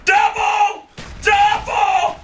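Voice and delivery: high, excited call; triumphant shout. Real, not acted. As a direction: crow over the double kill. Celebrating a double kill.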